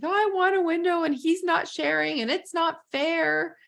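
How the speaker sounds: put-on high, whiny child's voice, half-sobbing; mock the little boy's whimpering complaint; imitating a crying child. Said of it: "the one boy came to me crying, like".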